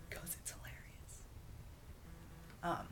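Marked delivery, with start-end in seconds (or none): 0.1-1.2 breathy whisper, slowed down; whispered aside. Real, not acted. Whispering to listeners that her clip is funny. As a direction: let the joke slip out in a whisper.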